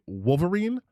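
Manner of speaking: "Wolverine" said WOHL-ver-een, rising; sample "Wolverine" as WOHL-ver-een, left hanging; pronunciation example. Shown as a demonstration. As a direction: offer "Wolverine" with an EEN ending. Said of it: "If he was, like, saying".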